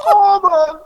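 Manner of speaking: high, loud, strained through laughter; laughing plea. Real, not acted. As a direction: beg for a second while cracking up. Laughing, can't finish the line.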